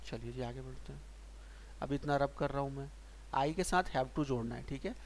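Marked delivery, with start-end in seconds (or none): none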